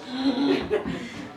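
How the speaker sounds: high, sharp intake of breath; nurse's startled gasp; shocked gasp. Retold or imitated. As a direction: gasp at the open wound.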